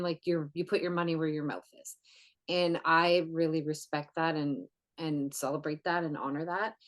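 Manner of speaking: ordinary delivery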